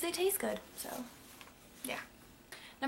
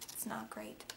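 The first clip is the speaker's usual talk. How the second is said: whispered aside; 12 dB quieter than usual. Real, not acted. Undercutting her own claim.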